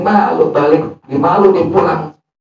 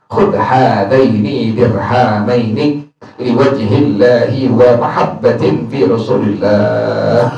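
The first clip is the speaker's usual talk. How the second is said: slow, lower chanted recitation of the Arabic text; 6 semitones lower, slower than usual. Acted. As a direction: intone the hadith slowly like scripture.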